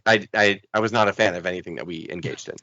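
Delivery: low, soft grumbling quote; a hint of a sour interviewee; Joe Thurman impression. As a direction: mutter it low and disapproving. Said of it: "He's like".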